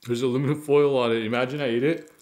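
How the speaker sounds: chewing between words; talking with food in mouth; mouth full while talking. Real, not acted. Seen 0: Just bit sandwich; jaw and cheeks move as he talks.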